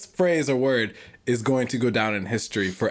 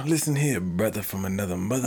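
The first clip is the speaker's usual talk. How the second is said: smooth jazz king; 6 semitones lower, faster than usual. Pretend. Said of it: "I'd be like".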